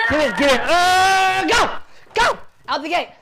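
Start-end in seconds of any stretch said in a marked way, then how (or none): 0.1-0.6 higher, louder urging; hype shout. Shown as a demonstration. Coach shouts to push the student into the room. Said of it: "Jump up and down". 0.6-1.4 long, much higher, much louder ah shout; full-throated yell. Shown as a demonstration. Coach pumps up the student with a long loud yell.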